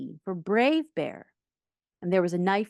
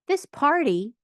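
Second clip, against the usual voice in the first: high, louder opening of a speech; boy's announcement; 8 semitones higher, 5 dB louder, slower than usual. A narrator's reading. Boy addressing guests at the party, high announcement.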